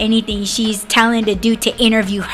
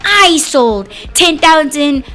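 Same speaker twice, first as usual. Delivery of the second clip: self-important painter mom; 3 semitones higher than usual. Retold or imitated. Mom lecturing students on her techniques, mock self-important. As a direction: imitate a boastful art teacher, stretching words for emphasis. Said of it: "That's how she talks".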